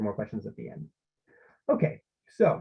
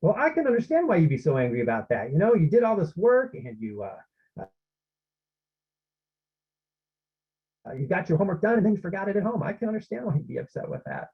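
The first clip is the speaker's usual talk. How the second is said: understanding tone; 5 semitones higher than usual. Shown as a demonstration. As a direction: lift your tone to show you truly get it. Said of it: "Gently raising your tone a bit to convey emotional understanding".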